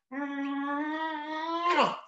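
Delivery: smack a big sloppy kiss; kiss sound. Acted. Bear kissing the bird's beak, a big smacking kiss.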